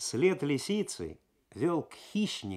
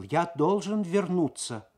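slow, higher and louder, every word firm; resolute announcement; 7 semitones higher, 6 dB louder, slower than usual. A narrator's reading. Losar announcing to the customs officers he must go back, resolute. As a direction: announce it as a decision not open to debate. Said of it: "и решительно объявил".

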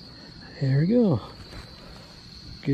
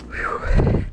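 winded whew. Real, not acted. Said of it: "Sorry, I'm breathing hard".

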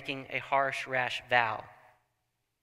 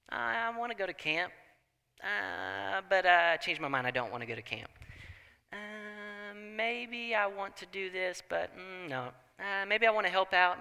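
higher, softer, mock-whiny dithering; whiny excuses; 4 semitones higher, 4 dB quieter than usual. Preacher mocking churchgoers who break promises, high whiny voice. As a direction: lazily talk yourself out of commitments.

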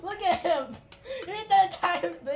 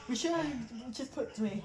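ordinary delivery

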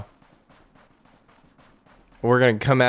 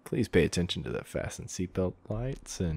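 low, softer captain on the intercom; pilot announcement; 5 semitones lower, 6 dB quieter than usual. Pretend. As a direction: play an airline pilot, half laughing. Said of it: "You sound like a pilot".

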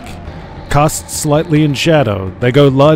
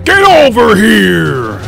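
higher, louder, slow roar; furious shout; 10 semitones higher, 5 dB louder, much slower than usual. Acted. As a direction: roar it as you yank the spear. Scorpion's iconic spear call.

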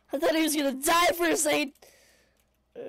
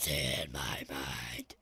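spooky whisper; 8 dB quieter, much slower than usual. Pretend.

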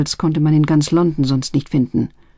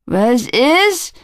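startled sleepy youth; 7 semitones higher than usual. Acted. Boy jolted awake from bed.

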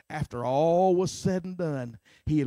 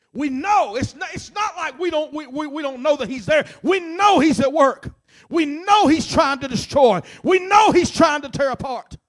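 louder and louder on each "We know"; rising preaching; 9 semitones higher, 12 dB louder than usual. Real, not acted. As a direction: build the sermon back to full force. Preaching builds again.

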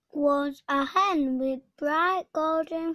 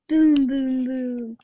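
loud heavy booms; booming footsteps; 7 dB louder than usual. A narrator's reading. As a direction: stamp out each boom. Giant's footsteps sounded.

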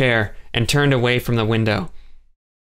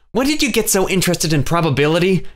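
snarky; 8 semitones higher than usual. A narrator's reading. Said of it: "Joelle snarked".

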